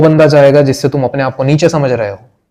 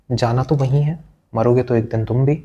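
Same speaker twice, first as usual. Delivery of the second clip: ordinary delivery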